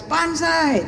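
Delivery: high-pitched mock battle cry; Japanese guy. Japanese guy in a joke claiming the girl, high cry. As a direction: call it out high, as the joke's Japanese character. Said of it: "So the Japanese guy goes".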